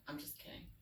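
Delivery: whispered aside. Real, not acted. Whispered aside after flub.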